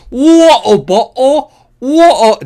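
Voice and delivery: very loud, high, stretched mock English; bad English accent. Room of actors practising, bellowed bad English accent. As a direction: bellow it in badly faked English. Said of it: "all they need to do the English accent".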